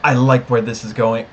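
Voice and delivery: usual speaking voice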